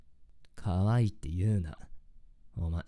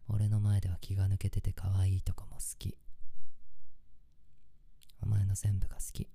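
tender breathy whisper. Acted. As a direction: whisper it lovingly in her ear. Boyfriend listing what he loves.

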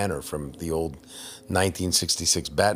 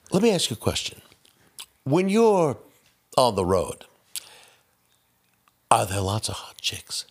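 Shatner impression; 5 semitones higher than usual. Shatner impression asking neighbor.

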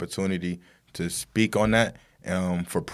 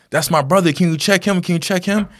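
high, louder urgent plea; acted desperation, voice raised high; retold pleading; 10 semitones higher, 4 dB louder than usual. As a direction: imitate a frantic brother begging for help. Acting driver begging for brother.

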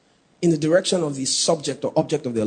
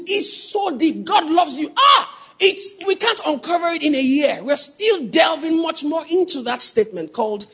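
louder, higher, driving preacher's cry; preaching at full force; 11 semitones higher, 4 dB louder than usual. Acted. Preacher presses the congregation on God's love, shouting. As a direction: build the point to the congregation.

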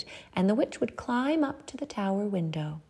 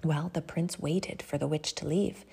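ordinary delivery, nothing marked

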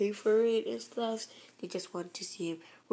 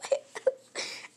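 fake sob. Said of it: "fake crying crocodile tears".

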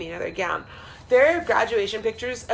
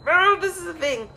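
lightly voiced, higher; mock high realization; 9 semitones higher than usual. Mocking her own younger self with a high mock voice.

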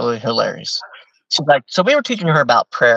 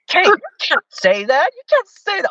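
scandalized elder. Retold or imitated. Missionary elder pleads with Kate.

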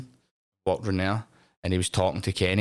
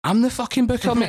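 much higher and louder, a mock-furious comeback; indignant retort; 14 semitones higher, 5 dB louder than usual. Telling the star who the booker really is, in a mock-furious outburst. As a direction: slam back the put-down in a hammed-up fury.

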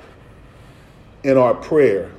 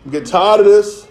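louder, higher acted scolding; fed-up parent; 5 semitones higher, 4 dB louder than usual. Father refusing his son a loan, acted exasperation. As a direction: scold him like an exasperated dad.

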